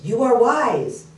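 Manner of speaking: acted echo of the dad's call. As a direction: call it back slowly, drawn out. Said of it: "The voice goes".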